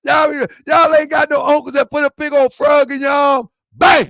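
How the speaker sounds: loud, high excited yell; shouting. Real, not acted. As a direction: belt it out at the crowd. Shouting at the audience.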